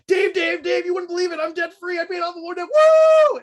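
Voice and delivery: high, breathless-rush excited shout ending in a big whoop; excited caller. Retold or imitated. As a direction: imitate an overjoyed caller shrieking the news. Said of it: "was so excited and nervous to be on the air".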